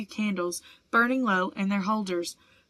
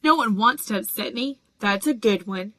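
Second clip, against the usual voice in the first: a mild howl, louder, voice pushed up; 4 dB louder than usual. A narrator's reading. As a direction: wail it out in self-pity, only lightly. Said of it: "howled Myrtle".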